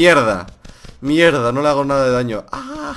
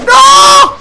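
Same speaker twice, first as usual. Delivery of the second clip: high, loud despairing cry; anguished shout; 13 dB louder than usual. Real, not acted. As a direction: yell it as you lose. Burned by enemies.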